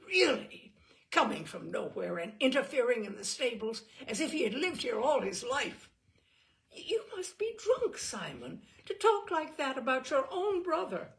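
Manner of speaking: high loud shocked rebuke; indignant. A narrator's reading. Eleanor scolding Simon for insulting his brother, loud. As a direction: scold him hotly.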